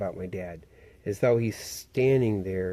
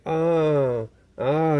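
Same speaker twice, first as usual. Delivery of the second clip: mock dismay; 6 semitones higher, 5 dB louder than usual. Friend reacting to shattered window.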